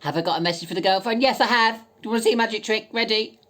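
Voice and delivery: loud and high, like a gameshow host; excited teasing. Acted. Taunting the girlfriend on camera, loud and gleeful. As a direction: tease her with big showy glee.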